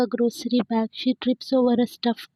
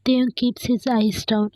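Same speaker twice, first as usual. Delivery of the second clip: usual speaking voice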